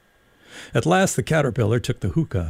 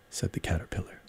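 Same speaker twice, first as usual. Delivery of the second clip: ordinary delivery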